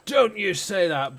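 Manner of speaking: loud, high, slow parental scolding; parent's sharp reprimand; stern telling-off. Retold or imitated. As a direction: snap a warning at the child. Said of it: "And I was like".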